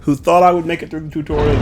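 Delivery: high, bright celebration; excited. Real, not acted. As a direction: cheer to the fans. Celebrating finishing tutorial.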